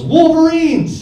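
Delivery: high, drawn-out mock battle cry; hammed-up movie-hero holler; acted battle yell. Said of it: "he gets stabbed and he yells".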